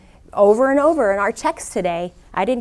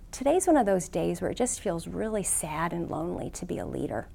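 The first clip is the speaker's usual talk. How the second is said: low, subdued admission of loneliness; quietly owning up to feeling down; sad confession; 3 semitones lower, 7 dB quieter than usual. Retold or imitated. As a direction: confess it softly, letting the voice sink at the end. Confessing sadness and loneliness to Clint.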